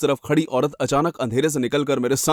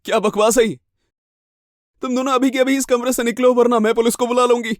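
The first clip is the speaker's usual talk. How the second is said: high, urgent, angry warning; indignant threat; 9 semitones higher than usual. Acted. Woman threatening two intruders with police, indignant and high. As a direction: order them out with raised pitch.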